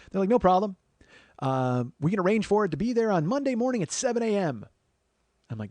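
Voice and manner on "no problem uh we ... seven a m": high, loud, overeager company voice; mock customer-service pep; chirpy booking agent. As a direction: promise it breezily, loud and high. Breezy cleaning company books appointment.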